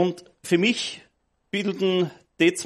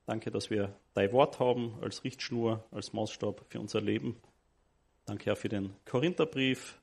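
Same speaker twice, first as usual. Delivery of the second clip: low, soft, reverent prayer; 7 semitones lower, 9 dB quieter than usual. Acted. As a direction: pray softly and humbly. Leads the congregation in prayer.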